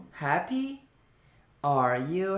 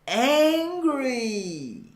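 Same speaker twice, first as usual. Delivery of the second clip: higher, exaggerated mock anger; hammed-up angry; 8 semitones higher, 3 dB louder than usual. Shown as a demonstration. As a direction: overdo the anger for the class. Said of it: "生气".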